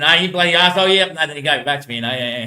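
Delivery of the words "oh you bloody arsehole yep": angry. Retold or imitated.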